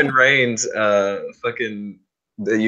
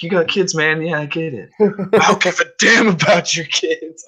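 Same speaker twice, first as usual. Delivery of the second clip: much higher, slowed mock brute; Brock Lesnar promo; 9 semitones higher, 3 dB louder than usual. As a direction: sneer it at the little guy like Brock. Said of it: "and he's like".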